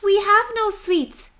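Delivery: small and timid; tiny ants. Acted. Ants answer the rat.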